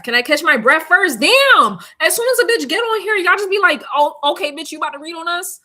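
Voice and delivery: loud, high-pitched complaint at the viewers; playfully indignant outburst; mock exasperation. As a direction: sound fed up, voice jumping up high. Mock exasperation at impatient live viewers.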